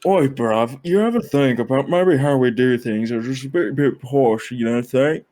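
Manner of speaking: mock British, drifting posh; British accent. Podcast host musing about being posh, British accent. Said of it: "different versions of British accents".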